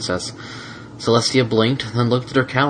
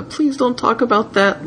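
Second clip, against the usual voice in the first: pleading Spike. Acted. Spike's imagined plea.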